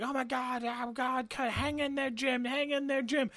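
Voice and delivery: high, strained hammed-up panic; mock panic. Retelling the drive to hospital, high mock panic. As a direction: overplay the panic in the car. Acting panicked driving scene.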